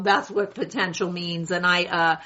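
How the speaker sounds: usual speaking voice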